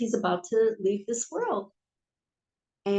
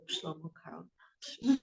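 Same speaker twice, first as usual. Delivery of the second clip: ordinary delivery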